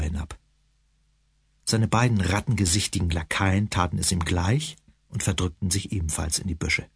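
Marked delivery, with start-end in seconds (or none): none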